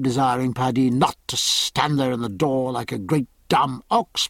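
ordinary delivery

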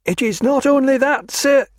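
a frightened lad begging rescue; tearful clerk. A narrator's reading. Clerk pleads to Stephen as to a saviour. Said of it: "said the clerk".

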